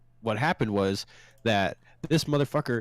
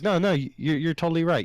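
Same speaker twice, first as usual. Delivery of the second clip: higher, sarcastic mock agreement; 9 semitones higher than usual. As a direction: play along with him, dripping sarcasm. Mock agreeing with angry customer.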